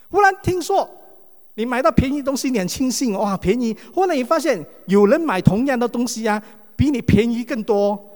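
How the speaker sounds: excited. Acted. Preacher telling the congregation about a bargain, loud excited build.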